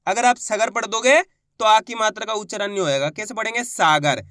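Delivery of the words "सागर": सागर with the first aa held long; long aa opening सागर; drawn-out vowel. Shown as a demonstration. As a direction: stretch the सा in सागर. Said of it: "सा को खींच के पढ़ेंगे".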